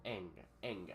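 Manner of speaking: "eng" with the n sliding back toward a g in the throat; velar n sound. Shown as a demonstration. Teacher demonstrating the velar n to students, nasal from the throat. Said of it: "La N velar se pronuncia como una N hacia una G".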